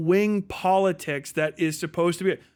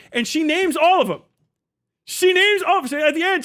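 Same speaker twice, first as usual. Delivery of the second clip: much higher, louder and gleeful; thrilled fan cheering her comeback; excited; 9 semitones higher, 4 dB louder, faster than usual. Real, not acted. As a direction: crow about it with delight. Gleeful retelling of debate win.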